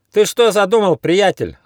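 loud, high, stern question; stern challenge. A narrator's reading. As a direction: confront the troublemaker sharply. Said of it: "строго спросил он".

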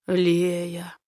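velvety, intimate man's voice; low velvety voice. Acted. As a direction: say her name low and velvety. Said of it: "низким бархатным голосом". (низкий бархатный голос)

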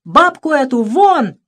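high, loud, drawn-out order; furious doctor's bellow; angry shout. A narrator's reading. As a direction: yell to throw her out. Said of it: "закричал я".

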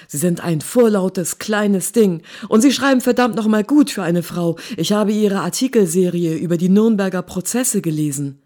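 loud, heated rant with grudging praise; gruff outburst. A narrator's reading. Featherston fährt sie gereizt an. (laut und gereizt herausgepoltert)

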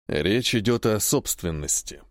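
a hint of a cold stranger; male voice. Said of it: "на этот раз голос мужской".

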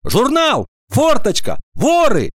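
high, piercing shouted exclamations; piercing cries. A narrator's reading. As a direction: yell out single words over a noisy class. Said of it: "вылетали пронзительные крики". (пронзительные выкрики)

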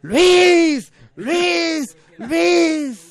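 very high, loud chant; multitud que grita. Acted. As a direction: shout it as a crowd in unison. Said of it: "todos gritan". (coro a gritos, muy agudo)

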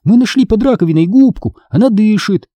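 put-on high-pitched, eager child's voice; urgent and excited; excited report. A narrator's reading. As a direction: blurt out the amazing news to Mum. Child urgently reporting an amazing find.

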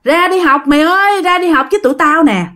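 high, insistent call to come along; repeated calling. Acted. School friends urging Huyền to come along, calling out. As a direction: call a friend out, stretching the name. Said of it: "Nghe tiếng kêu ơi ới".

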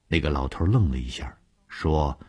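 usual speaking voice

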